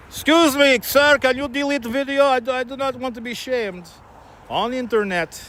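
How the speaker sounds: high, timid tourist plea; lightly voiced anxious tourist; pleading tourist. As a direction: mimic a nervous tourist begging. Imitating a tourist asking politely.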